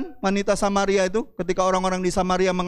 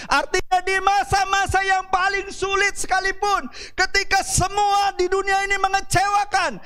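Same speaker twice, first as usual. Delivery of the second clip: high, sustained preacher's shout; full-force preaching; 14 semitones higher than usual. Real, not acted. Preacher listing failed harvests to the congregation, in full-force preaching. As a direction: build to a high-pitched, relentless sermon climax.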